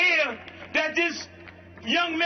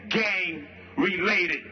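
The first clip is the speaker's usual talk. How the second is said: mock police verdict; 8 semitones lower, slower than usual. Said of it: "they say".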